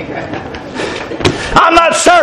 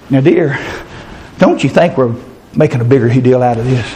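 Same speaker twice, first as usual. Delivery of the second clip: low, reasonable TV dad talking to his wife; lightly voiced husband, low and measured; placating husband; 12 semitones lower than usual. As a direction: gently talk her down, pitched low.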